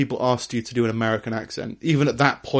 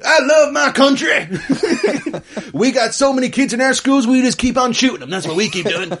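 mock Deep South accent; 11 semitones higher, 3 dB louder than usual. Brit mocking every American with a Deep South accent. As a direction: play a dumb Deep South patriot. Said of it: "do a Deep South accent".

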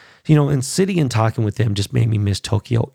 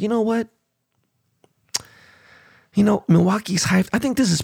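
self-quote; 10 semitones higher than usual. Retold or imitated. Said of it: "I was like".

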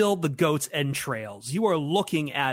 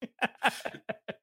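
laughing at own joke; high breathy chuckle; corny dad laugh; 10 semitones higher, 6 dB quieter than usual. Real, not acted. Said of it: "laughing at my own jokes".

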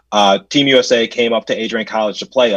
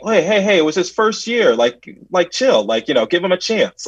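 high-pitched imitation of an objecting parent; mock protest, pitched up; lightly acted protesting parent; 8 semitones higher than usual. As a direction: plead the player's case in a raised voice.

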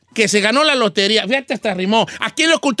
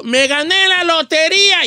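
higher, slow, proud announcement; mock jubilant proclamation; announcing a win; 5 semitones higher than usual. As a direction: proclaim it to everyone, stretched out. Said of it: "yo digo".

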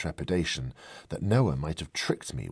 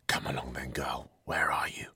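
breathy whisper, calling softly into the greenhouse; whispering to himself; 3 semitones lower, 3 dB quieter than usual. Acted. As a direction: whisper it as if afraid to be heard.

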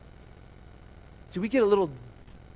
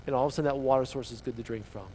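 ordinary delivery

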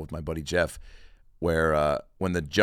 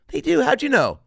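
put-on much higher, surprised little boy; squeaky kid voice; 9 dB louder than usual. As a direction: pipe up in wide-eyed wonder. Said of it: "Charlie answering shopkeeper".

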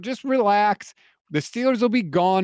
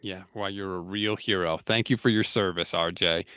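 dry sarcasm; 10 semitones lower, 5 dB quieter than usual. Real, not acted. Sarcastic reply to brag.

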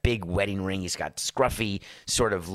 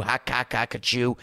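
train choo-choo noise. Pretend. Imitating a train sound.

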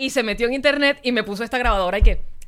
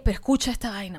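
ordinary delivery

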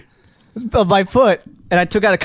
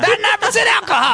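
high shouted party line; clip shout; 14 semitones higher than usual. Acted. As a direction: yell it high like a drunk reveler. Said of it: "reenactment of Dub's call".

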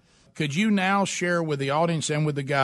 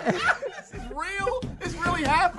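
laughing; 12 semitones higher, 3 dB quieter than usual. Real, not acted. Laughing disbelief.

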